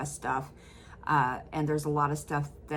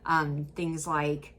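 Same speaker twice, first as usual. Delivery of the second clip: ordinary talk, nothing marked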